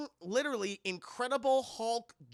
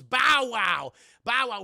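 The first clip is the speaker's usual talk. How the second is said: excited shout; 3 semitones higher, 11 dB louder, slower than usual. Real, not acted. Streamer telling chat the actor's reveal, excited shout. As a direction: shout the name out in delight.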